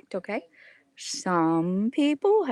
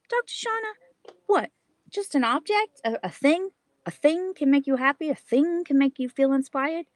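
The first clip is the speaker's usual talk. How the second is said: doubting listener; 6 semitones higher than usual. Retold or imitated. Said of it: "you may say to me".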